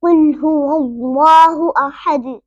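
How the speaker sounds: slow, full laa in हुवल्लाहु, echoing D in अहद; recitation with qalqala. Shown as a demonstration. Teacher recites the whole verse, qalqala on अहद. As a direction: recite deliberately, bouncing the end of अहद. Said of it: "इधर कलकला होगा".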